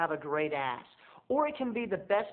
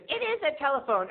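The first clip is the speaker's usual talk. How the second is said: grandma voice; 11 semitones higher, 4 dB louder than usual. Retold or imitated. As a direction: coo like a sweet confused grandma. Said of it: "then she goes".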